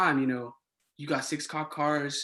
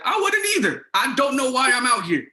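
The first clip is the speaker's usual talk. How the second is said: high and loud exasperated outburst; 9 semitones higher, 6 dB louder than usual. Retold or imitated. RA to his supervisor, high exasperated outburst. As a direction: blurt it out, fed up and alarmed. Agrees he shouldn't be there.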